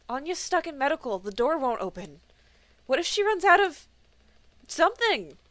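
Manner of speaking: high, loud frightened rush; panicked. Acted. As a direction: voice climbing in alarm. Panicked report of emergency.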